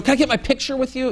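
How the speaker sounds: high, mock-adoring gush; gushing reporters. Reporters fawning over Obama, high mocking gush. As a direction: coo it like a starstruck fan. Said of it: "all these reporters are like".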